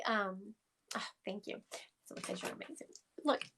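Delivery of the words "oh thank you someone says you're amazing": whispered thanks to the chat; whispered aside. Real, not acted. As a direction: drop to a breathy whisper. Aside to chat while reading.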